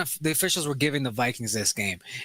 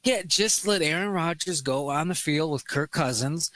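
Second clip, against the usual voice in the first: usual speaking voice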